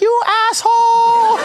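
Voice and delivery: very high, loud mock Indonesian shriek; bad Indonesian accent. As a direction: stretch the insult in a screechy Indonesian caricature. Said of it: "forgive me for this bad Indonesian accent".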